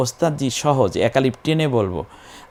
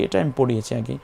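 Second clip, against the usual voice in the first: ordinary talk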